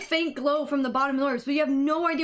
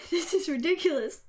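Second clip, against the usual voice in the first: ordinary talk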